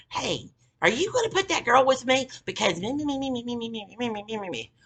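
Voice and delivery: high-pitched nagging, running into babble; mock complaining caller. Coworkers phone the boss to gripe about her, mock complaining. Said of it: "everybody else fucking called him about her".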